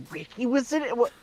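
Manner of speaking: very high impersonation, building to a shout; mock outrage in the actor's high voice; Richard Horvitz. Actor learning his friend took part, imitated in escalating high outrage. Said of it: "a really good Richard Horvitz impression".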